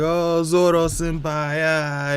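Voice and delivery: high, drawn-out mock wail; Bartolomeo's mock crying. Fan on the side crying over Zoro senpai, mock wailing. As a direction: imitate a fanboy crying, stretching the words out. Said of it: "he's crying".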